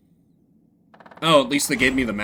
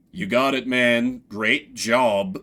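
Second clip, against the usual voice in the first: ordinary delivery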